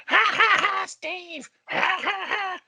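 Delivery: high, loud cackling cartoon crow. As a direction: cackle like a cheeky puppet crow. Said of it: "his crow is always".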